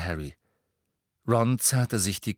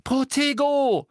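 high, drawn-out cry; urgent, stretched-out incantation; shouted spell; 14 semitones higher, 5 dB louder, slower than usual. A narrator's reading. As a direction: cast the spell with a loud shout. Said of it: "rief sie".

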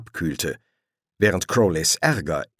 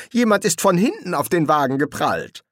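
raised, insistent correction; contradicting; 8 semitones higher than usual. A narrator's reading. Crowley corrects the angel about the crash, insistently contradicting. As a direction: insist it was the other one's fault. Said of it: "widersprach Crowley".

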